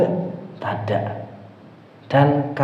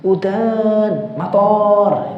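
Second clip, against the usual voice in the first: udan and mator said higher in a mock Javanese accent; playfully Javanese mator with a high pitch; 7 semitones higher, much slower than usual. Teacher imitates Javanese students saying rain. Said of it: "Kalau orang Jawa".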